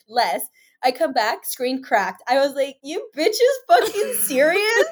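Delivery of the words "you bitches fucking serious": high, loud incredulous challenge; retold outrage. Girl finding her phone screen cracked, retold outrage. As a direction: demand it in pitched-up disbelief.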